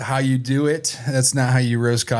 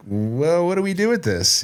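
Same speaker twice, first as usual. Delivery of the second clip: puzzled; 9 semitones higher than usual. Retold or imitated. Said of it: "kinda looked at it like".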